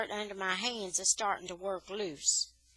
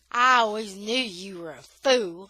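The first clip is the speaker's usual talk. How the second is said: contemptuous insult; 9 dB louder than usual. Acted. J-Boy insults the narrator.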